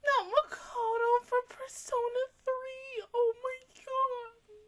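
high, slow, tearful whimper; crying wail. Real, not acted. Upset fan to the camera, slow tearful wail. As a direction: sob out the disbelief. Reacting to character's voice actor.

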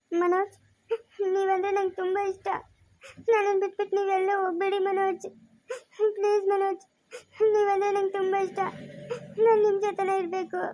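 high, sobbing love confession; weepy, pleading voice; crying plea. Acted. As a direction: beg through tears. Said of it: "ಯಾಕೆ ಅಳ್ತಿರೋದು ನೀನು".

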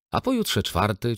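put-on cheeky child. A narrator's reading. Little Litka cheekily continues her father's list, teasing. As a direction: chime in teasingly like a little girl. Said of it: "ciągnęła Litka".

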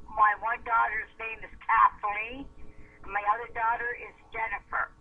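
ordinary talk

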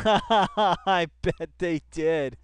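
slow, loud laughing jab; laughing sarcasm. Real, not acted. Streamer mocking the article, laughing. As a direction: laugh it out knowingly.

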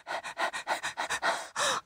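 tired dog catching its breath; dog panting. Acted. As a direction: pant like a worn-out dog. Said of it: "lying by the road and panting".

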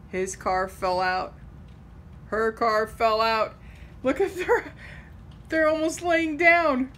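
ordinary delivery, nothing marked

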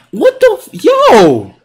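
shocked yell. Real, not acted. Shocked by the play.

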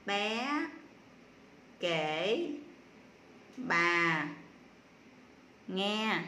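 each word alone, with long pauses between; word-by-word reading. Shown as a demonstration. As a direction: read it one isolated word at a time. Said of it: "đánh vần và đọc lại". (đọc rời từng tiếng, ngắt lâu)

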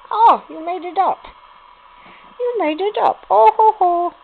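delighted high coo at the puppy. Real, not acted. Talking to puppies.

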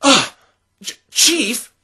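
high, loud startled stammer. Acted. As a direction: stammer in shock at who it is. Startled recognition.

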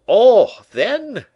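loud, high exclamation; eager cry. A narrator's reading. Painter Lebrun leaps at the idea, crying out. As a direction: cry it out, pitched right up. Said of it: "cried Lebrun".

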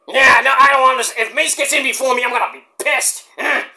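hammed-up annoyed wrestler; Mike Awesome impression. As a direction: complain like a snubbed wrestler. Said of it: "Mike Awesome impression".

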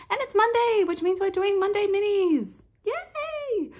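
high, loud, bubbly intro; excited cheer. Real, not acted. Host cheering to viewers, excited and high.

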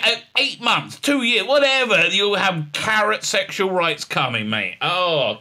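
ordinary talk, nothing marked

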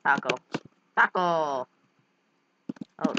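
usual speaking voice

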